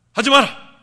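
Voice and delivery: high, booming scolding shout; thundering stern command; loud rebuke. Acted. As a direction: shout it to startle the wrongdoer. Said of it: "책망을 하면은 깜짝 놀라요".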